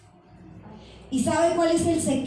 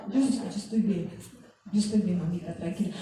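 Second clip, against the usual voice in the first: reassuring; 4 semitones lower, 5 dB quieter than usual. Retold or imitated. Daughter reassures worried mother.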